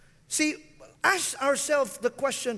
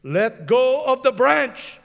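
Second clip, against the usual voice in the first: slow, loud God command; 7 dB louder, slower than usual. Retold or imitated. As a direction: give the order calmly and firmly. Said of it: "And so God says".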